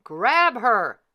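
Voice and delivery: loud, urgent command; shouted order. Acted. King shouting to his men to seize the fairy. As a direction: bark it at the guards. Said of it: "cried the king".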